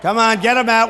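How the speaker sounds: loud barking commands at security; loud demand to security to hurry; shouted order. Real, not acted. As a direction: shout it across the hall. Ordering protesters removed.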